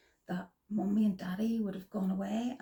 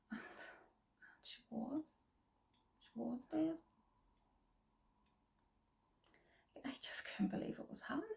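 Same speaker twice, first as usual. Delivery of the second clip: quiet, breaking voice, close to crying; grieving mother, voice catching on the memory; near tears; 3 semitones higher, 11 dB quieter, faster than usual. Real, not acted. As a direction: fight back the tears as the words come. Mother recounting daughter's death.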